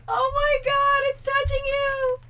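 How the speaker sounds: high, slow shocked squeal. Real, not acted. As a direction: squeal in disbelief. Shocked at the strip act.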